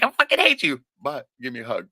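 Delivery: high, laughing fake outburst; laughing mock quote. Imitating someone disarmed by kindness, laughing. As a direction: laugh through a fake angry line. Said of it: "You're like".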